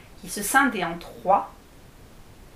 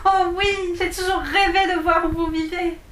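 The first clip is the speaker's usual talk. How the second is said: put-on high, louder delighted girl; thrilled exclaim; 8 semitones higher, 4 dB louder than usual. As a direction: gush with childish excitement.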